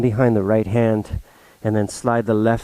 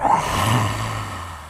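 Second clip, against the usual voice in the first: lion's breath exhale. Shown as a demonstration.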